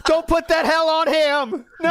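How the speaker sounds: high, loud, slow mock drama; hammed-up plea. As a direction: plead it like a melodrama.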